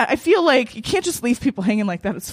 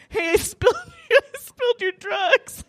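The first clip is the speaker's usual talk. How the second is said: mortified; 10 semitones higher than usual. Retold or imitated. Woman confessing to her date that she spilled his drugs, mortified. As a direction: squeak out an embarrassed admission, half laughing. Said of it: "I'm mortified".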